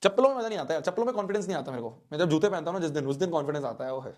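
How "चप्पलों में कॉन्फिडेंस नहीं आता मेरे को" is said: usual speaking voice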